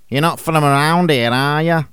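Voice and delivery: lad's Manc greeting; Manc accent. Shown as a demonstration. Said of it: "listen to their equivalents in Mank dialect".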